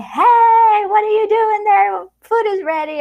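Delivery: high, loud call to another room; playful high loud holler to family that food is ready; shouting across rooms. Retold or imitated. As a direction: call out to someone down the hall. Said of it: "it's a very Latin American thing to shout at each other".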